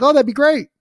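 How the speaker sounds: high, loud, thrilled "that'd be great"; delighted husband. Retold or imitated. Homeowner happily accepts the cleaning offer, thrilled and loud. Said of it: "He's like".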